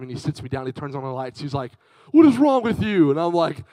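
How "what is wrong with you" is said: raised, higher mock-scolding voice; retold fatherly outburst; exasperated dad. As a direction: scold the child loudly in disbelief. Dad confronts screaming child.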